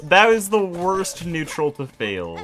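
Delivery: loud, slow, exasperated outburst; frustrated yell. Real, not acted. Failed the jump while speedrunning.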